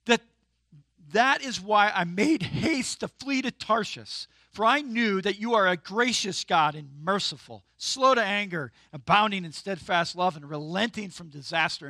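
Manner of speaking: loud, indignant scripture reading; reading with attitude. Shown as a demonstration. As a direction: read it like a sulky, resentful prayer. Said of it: "why are you reading with an attitude".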